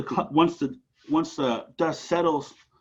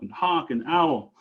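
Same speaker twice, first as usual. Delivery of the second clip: plain reading voice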